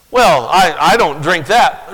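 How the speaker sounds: high, loud whiny protest; mock excuse-maker. Preacher voicing a sinner's excuses, whiny and loud. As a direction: whine like someone dodging blame.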